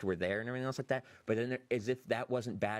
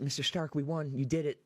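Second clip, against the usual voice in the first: higher-pitched imitation of a distraught young hero; breaking down, imitated; 5 semitones higher than usual. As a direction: plead it higher, lightly acting the breakdown. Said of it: "breaks it".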